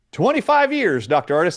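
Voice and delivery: high-pitched mock nurse reply; retold high-voiced answer; imitating the nurse.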